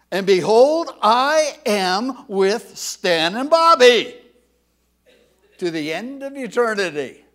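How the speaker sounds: preacher proclaiming the promise loudly; emphatic personalised verse. Shown as a demonstration. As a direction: put your own names in and proclaim it. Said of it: "I've emphasized the word you".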